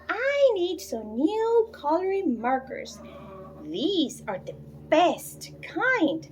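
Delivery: put-on high, pleading little girl voice; a small daughter nagging her mother for markers; little girl begging. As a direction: whine for markers like a small child. Bridget asks for indelible markers.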